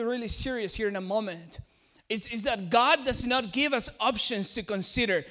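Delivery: ordinary talk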